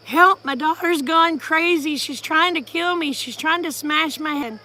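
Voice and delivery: loud, high-pitched mock cry for help; retold frantic scream. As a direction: imitate a panicked stepdad pleading down the phone. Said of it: "He was screaming and crying".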